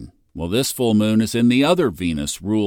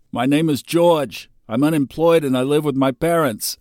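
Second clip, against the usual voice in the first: higher, a bit louder deadpan intro; George impression; 5 semitones higher, 3 dB louder than usual. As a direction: say it like a TV character. Quoting Seinfeld George.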